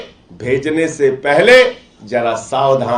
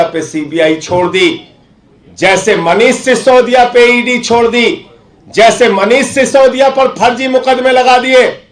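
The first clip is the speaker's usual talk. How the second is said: imitated threat, building higher then easing at the end; retold threat; 5 semitones higher, 4 dB louder than usual. Relaying BJP contacts' offer to MLAs, mock threatening. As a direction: quote their menacing ultimatum, pressing harder with each example. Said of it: "धमकी भरे शब्दों में कहते हैं".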